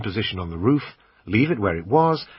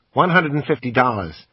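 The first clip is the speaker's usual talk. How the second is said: a higher, louder American salesman pitching the camera; pawn shop salesman; 4 semitones higher, 5 dB louder than usual. Acted. Assistant pitching the camera to the customer, salesman voice. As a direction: sell it like a pushy shop assistant.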